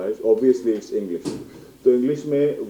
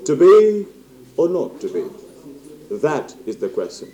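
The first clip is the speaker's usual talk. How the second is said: high, loud opening falling to a slow, hushed close; stage-actor Hamlet, drawn out and weighty; theatrical Hamlet; 5 semitones higher, 3 dB louder, much slower than usual. Shown as a demonstration. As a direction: deliver it as a slow dramatic soliloquy with long pauses. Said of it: "اب آپ ایسے بولیں گے تو وہ بکے گا".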